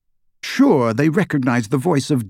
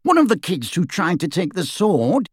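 higher, brisk fugitive wizard; rough wizard voice; 6 semitones higher than usual. A narrator's reading. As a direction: voice him as a cocky escaped wizard. Dirk, higher character voice.

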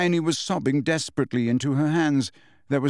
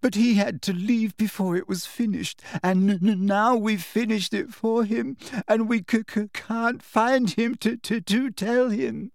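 high, tearful stammer; gasping; 8 semitones higher than usual. A narrator's reading. Hermione confessing falsely to Umbridge, gasping stammer. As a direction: stumble on consonants as if sobbing. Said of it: "gasped Hermione".

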